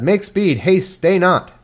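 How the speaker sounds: urgent call, pitched high; crying out. A narrator's reading. Jonathan urges the lad to hurry, high urgent call. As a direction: hurry him along from afar. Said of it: "And Jonathan cried after the lad".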